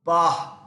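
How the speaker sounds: a loud puffed "pah" of disgust; scornful snort. Acted. Teacher voicing the fed-up suitor, scornful snort. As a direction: snort it out in contempt.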